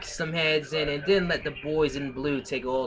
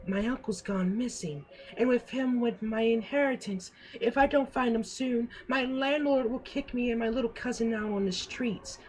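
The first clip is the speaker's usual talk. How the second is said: higher, pleading dame voice; damsel in distress voice; 11 semitones higher than usual. Acted. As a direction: plead like a helpless noir dame. Voicing the dame in noir story.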